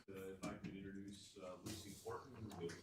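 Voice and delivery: distant and faint, away from the microphone; off mic. Real, not acted. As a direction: speak from far off the mic. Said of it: "I'm sorry, if I could introduce".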